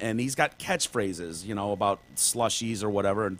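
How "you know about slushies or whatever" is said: ordinary talk, nothing marked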